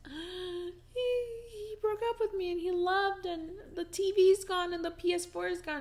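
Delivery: high, loud, sobbing retelling through tears; crying. Retold or imitated. Crying to her roommate about the breakup, high and sobbing. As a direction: wail it out like you're falling apart.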